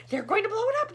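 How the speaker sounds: high, loud panicked yell; a loud frantic cry. A narrator's reading. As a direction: yell the alarm. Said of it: "yelled ground control".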